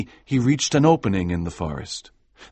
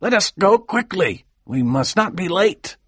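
put-on old minister urging haste; 7 semitones higher than usual. Minister urging the deacon to hurry, raised pitch. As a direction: hurry him along, an old preacher. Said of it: "replied the old minister".

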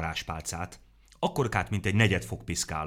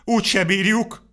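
high, louder despairing wail; a despairing higher moan; whining lament; 14 semitones higher, 9 dB louder than usual. A narrator's reading. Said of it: "sápítozott Vacska Mati".

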